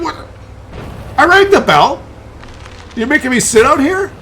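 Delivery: high-pitched, indignant dog voice; Marty freaking out. Retold or imitated. Dog protesting to owner about waiting outside, freaking out. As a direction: play an affronted dog, way up high. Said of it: "He's, like, freaking out".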